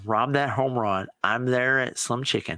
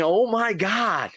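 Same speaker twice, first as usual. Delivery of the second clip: higher, drawn-out mock yell; excited scream; 8 semitones higher, slower than usual. Retelling jumping up in the restaurant, excited scream. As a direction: replay the joy of that catch.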